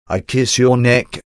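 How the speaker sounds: normal talking voice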